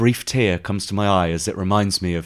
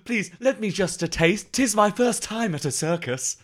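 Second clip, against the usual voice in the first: higher, archaic pleading with a laugh; mock Shakespearean plea; 9 semitones higher than usual. Boy begging vendor for a taste, mock Shakespearean. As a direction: beg in olde English, lightly hammed. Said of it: "all Shakespearean".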